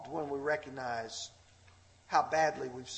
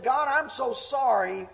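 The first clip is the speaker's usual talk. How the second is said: tearful apology, imitated; 8 semitones higher, 7 dB louder than usual. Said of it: "And he's crying".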